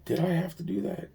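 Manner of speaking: puzzled question to himself; muttered to himself. Real, not acted. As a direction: wonder aloud under the breath.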